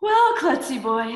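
put-on high, sighing sleepy girl voice; a drowsy girl suggesting a nap; sleepy Schluffy Girl.

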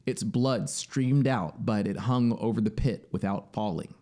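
ordinary delivery

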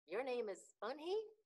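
rising pitch of storybook surprise; wide-eyed question. A narrator's reading. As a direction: ask it in mild amazement. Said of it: "asked her with his eyes open wide".

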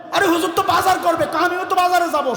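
put-on high pitched childish demand; boy whines he deserves the same as the cook; whining child. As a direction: argue like a spoiled boy. Boy demanding equal share.

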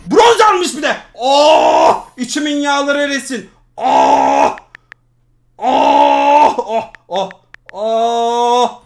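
loud high shouts of relief; celebrating the win with loud groans of relief; elated cries. Real, not acted. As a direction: cry out with joy.

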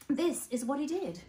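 ordinary delivery

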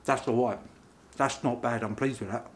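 talking through a mouthful; chewing between words; mouth full, chewing. Real, not acted. Seen 0: Bites burger at -4; jaw still chewing in early frames.

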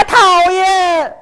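high, drawn-out mock huff; mock indignant child. Child whose idol was insulted, mock-indignant squeal. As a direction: squeal it in exaggerated annoyance. Acts offended child's retort.

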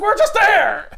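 high, loud comic outburst; exasperated protest. Acted. Turtles objecting to another space trip, high mock protest. Acting the characters' protest at the plan.

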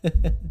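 short high burst of laughter; real laugh. Real, not acted. Coach breaks off commentary, tickled by an interruption, laughing. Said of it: "why I'm laughing".

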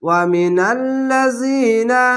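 ওয়া মিনাল লাযিনা slow, with the long vowel of লাযিনা held; drawn-out recitation. Shown as a demonstration. Teacher showing students the long vowel, slowly. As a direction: hold each long vowel, slowly and clearly. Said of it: "এক আলিফ টেনে পড়বেন".

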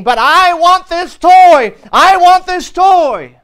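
put-on high, loud, drawn-out child's whine; loud bratty child demanding the toy; whiny little girl. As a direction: wail it like a spoiled little girl. Girl tantrum in store.

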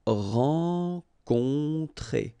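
"rencontrer" drawn out very slowly, rahn-kohn-TRAY, both nasals held; very slow model word. Shown as a demonstration. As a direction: stretch every syllable of "rencontrer". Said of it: "O N, on".

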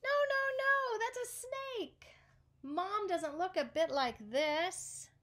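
little monkey squeals no, no, no at the snake; monkey protesting. Acted. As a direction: squeal out a frantic denial.

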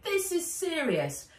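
upset Nat. Acted. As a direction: insist on each big word in a high voice. Narrator voices Nat, upset.

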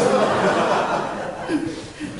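drawn-out breathy laugh; long amused laugh. Real, not acted. Preacher laughing at the coworker's refusal, amused. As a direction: laugh it off at the punchline.

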